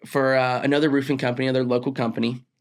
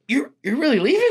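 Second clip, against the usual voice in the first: much higher, laughing disbelief; surprised; 10 semitones higher than usual. Retold or imitated. Boss stunned his employee is leaving, surprised laughing. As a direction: caught off guard, half laughing. Boss hears he's quitting.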